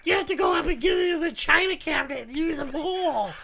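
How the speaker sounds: high, hectoring mother-in-law voice; scolding mother-in-law. Acted. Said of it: "that's a pretty good impression".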